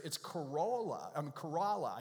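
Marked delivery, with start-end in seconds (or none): 0.2-1.1 Corolla said kuh-ROH-la; mispronunciation. Shown as a demonstration. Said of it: "I've mispronounced this for years". 1.3-2.0 Corolla said kuh-RAH-la; corrected local pronunciation. Shown as a demonstration. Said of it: "I even do it".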